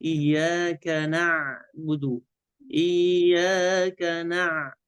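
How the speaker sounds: إياك نعبد slow, every letter thin and light; light-letter recitation. Shown as a demonstration. Sheikh models the verse for learners, slow and light. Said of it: "النون مرققة".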